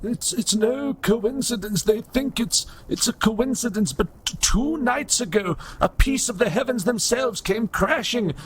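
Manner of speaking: put-on high, troubled old robot priest; old broken robot. Robot telling inspector of heavenly disaster, high and troubled. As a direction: recount it like a doom-struck prophet, pitched high.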